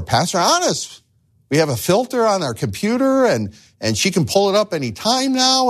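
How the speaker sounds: husband's protest, pitched high and pleading; upset husband. Retold or imitated. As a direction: insist you've changed, voice climbing. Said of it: "He says to me".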